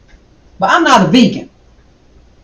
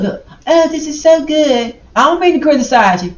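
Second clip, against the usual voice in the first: sarcastic gushing; 7 semitones higher than usual. Acted. Sarcastic praise.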